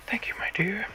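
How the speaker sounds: put-on high, whispery little old lady; granny voice. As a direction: breathe it like a sweet old granny. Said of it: "That's my granny voice".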